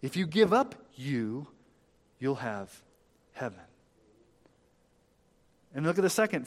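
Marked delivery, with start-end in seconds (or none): none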